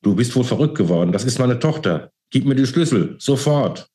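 rising-pitched outrage; furious commands without much added volume; angry roar. A narrator's reading. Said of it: "brüllte er Karl Wagner an".

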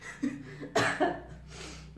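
high, breathy giggle; a real giggle at the video; amused laugh. Real, not acted. Laughs at the clip.

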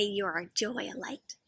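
soft unvoiced whisper; hushed, almost silent reading. Shown as a demonstration. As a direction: breathe the words like a secret. Repeating the letter words softly.

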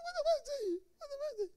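high, laughing squeaky voice; mock squeaky husband. Preacher retelling his quarrel reply, high laughing squeak. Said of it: "I said".

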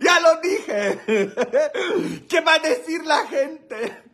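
high words through real laughter; laughing squeal. Real, not acted. Comedian who just told the naughty joke, laughing squeal. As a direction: squeal it out while cracking up.

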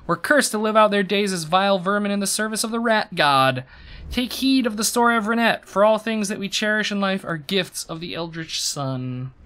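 emphatic, dramatic lore narration; resume the tale with storyteller force; story narration. A narrator's reading. Streamer finishing the fable for chat, dramatic storytelling.

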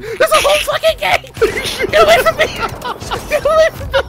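high, loud shrieking through laughter; panicked shrieking. Real, not acted. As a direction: shriek in mock terror. Ganged up on in game.

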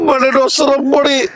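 usual speaking voice

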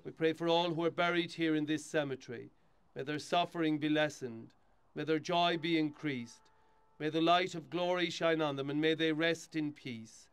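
steady, solemn prayer reading; hushed graveside prayer. Acted. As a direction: lead the mourners in prayer. Priest leads graveside burial rite.

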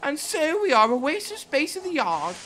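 Annie's high upset voice. Acted. Coach Annie tells Thomas what the trucks said, high and upset. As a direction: pitch it high and loud, upset at the insults.

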